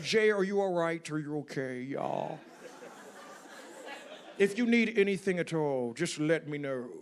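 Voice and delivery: imitated older-boss voice, dropping low at phrase ends; comic impression of a concerned boss; Lorne Michaels. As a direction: play the TV boss checking on his protégé. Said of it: "Lorne Michaels called my cell phone".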